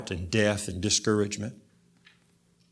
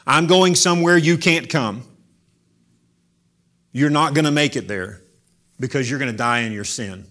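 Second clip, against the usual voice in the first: louder, driving warning; forceful preaching; 3 semitones higher, 6 dB louder than usual. Acted. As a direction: press the warning hard on the crowd. Paraphrases Jesus at full force.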